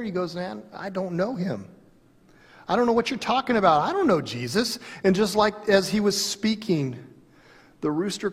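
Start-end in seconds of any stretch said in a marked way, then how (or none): 0.3-4.8 rising, rushed denial; panicked denial. Retold or imitated. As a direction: blurt it out in panic. Peter denies Jesus in panic.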